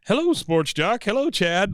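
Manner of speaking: high, familiar phone greeting; Bill. Retold or imitated. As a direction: greet them like long-time friends. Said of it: "like he's known him for a long time".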